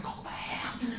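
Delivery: breathy, voiceless croak of a lost voice; croaking whisper. Retold or imitated. Preacher showing the brother his ruined voice, voiceless croak. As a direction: rasp it out, the voice is gone.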